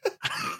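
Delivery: high, soft laugh; helpless laughter. Real, not acted. Co-host cracking up at the accent attempt, high laughter.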